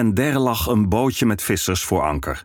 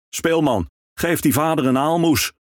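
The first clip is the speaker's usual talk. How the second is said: higher, calling across from the boat; ferryman calling out; 8 semitones higher than usual. Acted. Ferryman calls from his boat to the minstrel, calling out. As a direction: call out over the water. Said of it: "riep de veerman vanuit de boot".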